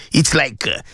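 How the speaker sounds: ordinary talk, nothing marked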